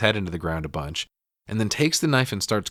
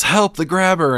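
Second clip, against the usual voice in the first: louder, higher cry for help; retold yell; 9 semitones higher, 6 dB louder than usual. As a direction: call out in alarm, retelling it. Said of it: "Finney yells".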